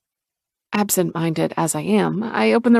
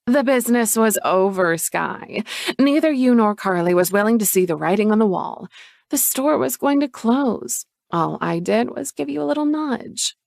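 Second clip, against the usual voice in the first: higher, condescending sister; pitched-up dismissive sister; dismissive Chloe; 3 semitones higher than usual. Acted. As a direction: explain it away coolly.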